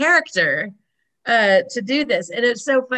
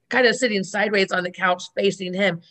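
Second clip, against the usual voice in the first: normal talking voice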